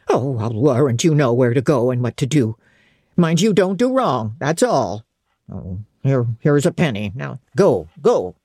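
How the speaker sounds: the servant turning a vagrant away, stern; stern old servant. Acted. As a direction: brush off the beggar firmly. Hannah sends her away.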